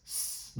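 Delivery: "s" hissed with no voice; a long unvoiced hiss of "s"; voiceless s. Shown as a demonstration. Teacher isolating the final sound, unvoiced hiss. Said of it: "ou s".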